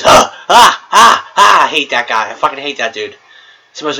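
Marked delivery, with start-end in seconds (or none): 0.0-1.6 high, loud mock cackle; mocking laugh. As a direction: imitate his annoying laugh. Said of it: "oh, he goes".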